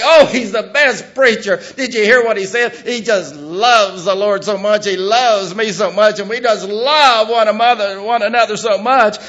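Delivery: loud, high gushing church member; gushing congregation. Retold or imitated. Preacher mimicking a flattered congregation, loud gushing mock. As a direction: gush over the preacher in mock delight. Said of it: "telling them what they want to hear".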